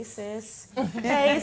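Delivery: normal talking voice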